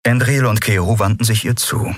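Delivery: plain reading voice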